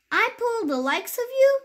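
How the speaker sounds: high, scoffing rise on you; sneering disbelief; scornful question. Acted. Proud shiny engine refuses the toys, high and scoffing. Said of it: "shiny new engine snorting".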